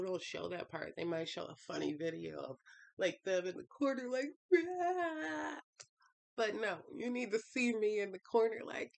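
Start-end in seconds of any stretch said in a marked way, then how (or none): 4.5-5.6 short high mock wail; mock cry. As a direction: let out a little faked crying wail. Imitating someone crying in corner.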